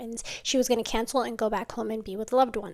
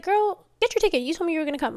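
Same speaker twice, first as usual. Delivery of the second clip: insistent urging; 6 semitones higher, faster than usual. Retold or imitated. Pressing a flaky friend to buy her ticket, high insistent voice.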